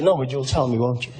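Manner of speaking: low-pitched, deadpan comeback; dry resigned reply. Retold or imitated. Comic humours the UK man's coming lecture, low dry voice. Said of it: "you know what your problem is".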